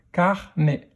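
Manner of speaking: "carnet" split into car, nè; a small gap inside "carnet"; syllable by syllable. Shown as a demonstration. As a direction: sound out "carnet" in two beats. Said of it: "dans quelle syllabe".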